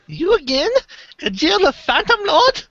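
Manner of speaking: very high squeaky voice; high character voice. Acted.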